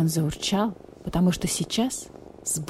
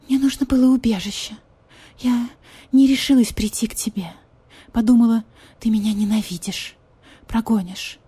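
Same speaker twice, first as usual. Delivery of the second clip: higher voice, breaking into whispers; pitiful pleading cat lady; 5 semitones higher than usual. Acted. Marquise pleads, whispered parts.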